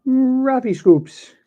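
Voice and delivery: high, loud and slow; excited. Real, not acted. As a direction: announce the pull with delight. Excited card pull.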